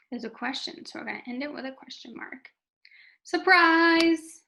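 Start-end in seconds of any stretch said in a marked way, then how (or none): 3.2-4.4 loud, high exclaimed shout; exclamation. Shown as a demonstration. Teacher demonstrating an exclamation point, exclaiming. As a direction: call it out like springing a surprise. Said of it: "has an exclamation point".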